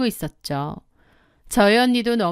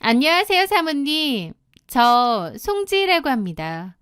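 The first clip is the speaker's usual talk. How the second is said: high, cheeky greeting that settles down; brazen; 4 semitones higher than usual. Acted. Mistress introducing herself to the wife by phone, brazen. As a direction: greet her with shameless confidence. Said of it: "아주 당돌한 목소리로 전화를 받더군요".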